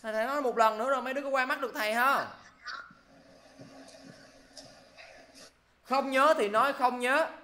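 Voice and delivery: loud, high-pitched telling-off; angry scolding. Real, not acted. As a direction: scold a student you caught cheating. Scolding student for using the dictionary.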